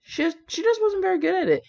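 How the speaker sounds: high, light voice for Merlin's mild reply. A narrator's reading. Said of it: "Merlin said mildly".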